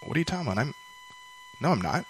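small-voiced, baffled denial, lightly acted; confused denial. Girl answering her cousin's taunt, confused soft denial.

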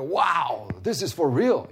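amazed traveler's wow. Retold or imitated. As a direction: react in awe, stretching the wow. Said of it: "And so we go there like".

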